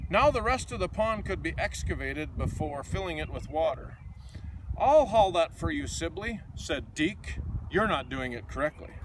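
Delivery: lively, sing-song picture book reading; reading to children. A narrator's reading. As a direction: read it like a bedtime storybook for kids.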